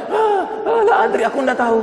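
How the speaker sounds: the lost soul who cannot answer, flustered; panicked stammer. Acted. Unbeliever stammers a third time. (gagap panik dengan suara jauh lebih tinggi)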